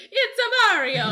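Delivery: high, loud cartoon Italian plumber cry; Mario impression. Brother in costume going around as Mario, high and loud. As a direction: sing it out high like a game mascot. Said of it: "my oldest brother was Mario".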